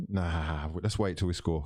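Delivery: normal talking voice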